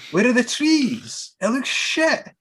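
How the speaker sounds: high, slow unimpressed voice; mock disappointment, drawn out high; unimpressed tribe. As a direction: tribespeople scoffing at the moon photos. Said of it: "they're like".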